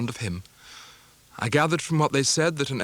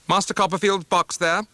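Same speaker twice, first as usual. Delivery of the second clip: curt spinster; 8 semitones higher, 4 dB louder than usual. Acted. Spinster announcing departure.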